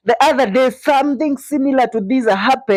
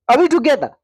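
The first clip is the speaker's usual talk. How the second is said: ordinary talk, nothing marked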